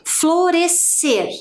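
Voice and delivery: "Florescer" said very slowly, syllable by syllable. Shown as a demonstration. Teacher demonstrating pronunciation, slow. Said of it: "Vou fazer a pronúncia".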